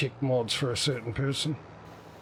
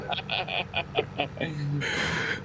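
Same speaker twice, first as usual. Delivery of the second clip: long chuckling fit; laughter. Real, not acted. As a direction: burst out laughing at your own slip. Laughing at the word mix-up.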